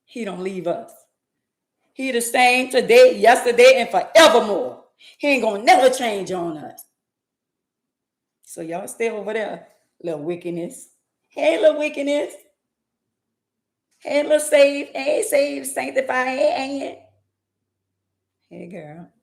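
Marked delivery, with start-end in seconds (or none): 1.9-6.9 full-force preaching. Acted. Preacher proclaiming God's promise to the congregation, building to a shout. 11.3-17.0 mock sing-song greetings. Calling out in greeting.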